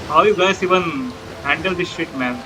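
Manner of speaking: ordinary delivery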